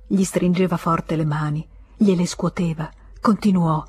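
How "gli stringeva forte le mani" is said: ordinary delivery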